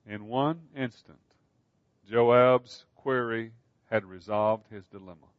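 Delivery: ordinary delivery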